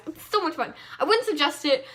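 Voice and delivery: usual speaking voice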